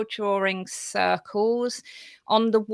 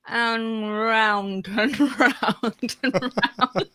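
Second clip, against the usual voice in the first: laughing; much slower than usual. Real, not acted. Laughing during chewing exercise.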